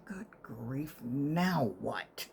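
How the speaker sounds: breathy whisper to herself; hushed exasperated whisper; whispered thought. Retold or imitated. Whispering to herself as the nurse asks for help, hushed. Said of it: "I'm thinking".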